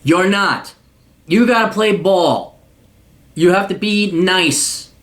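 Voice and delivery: ordinary delivery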